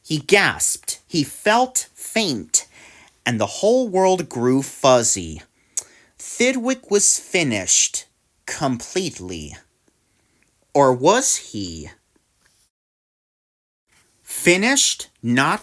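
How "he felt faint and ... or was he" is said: usual speaking voice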